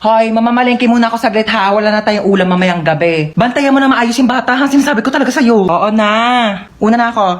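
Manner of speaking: usual speaking voice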